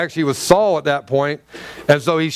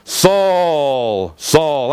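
booming voice of God; 3 semitones lower than usual. Retold or imitated. Preacher voicing God calling to Saul, lightly deep and big. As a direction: call out as God, a touch deep. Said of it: "I can imagine he's going".

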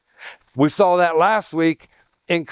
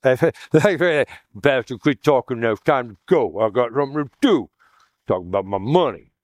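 mock congregant; 5 semitones lower than usual. Mocking an impatient congregant.

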